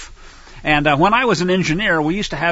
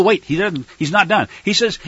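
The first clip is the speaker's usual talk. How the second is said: normal talking voice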